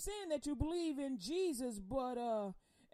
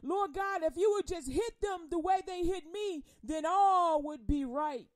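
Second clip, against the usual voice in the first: louder, pleading cry to God; loud pleading lament; women crying out; 5 semitones higher, 8 dB louder than usual. Acted. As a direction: beg God for justice out loud. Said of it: "the women crying".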